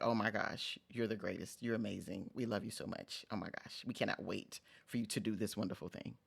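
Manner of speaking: a hint of fawning admirers cooing; gushing praise. Vlogger imitating colleagues praising her, softly gushing. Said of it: "a meeting where I'm told".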